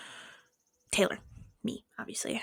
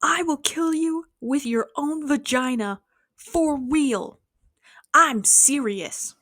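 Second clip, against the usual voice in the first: slow, higher mock threat from an unhinged stranger; lightly voiced menacing weirdo, drawn out and a bit louder; retelling a strange man's threat, slow and high; imitating a crazy man; 5 semitones higher, 3 dB louder, much slower than usual. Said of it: "he goes".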